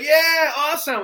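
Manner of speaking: high, loud mock enthusiasm; imitated cheering. People he knew reacting to his video, imitated cheering. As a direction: cheer it like a supportive friend. Acting out supportive friends' reaction.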